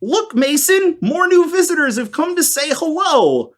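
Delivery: high, sweet small-town lady voice; lightly laughing hostess greeting strangers; small-town woman. Acted.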